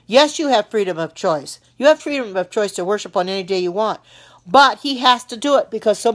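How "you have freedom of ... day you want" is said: ordinary delivery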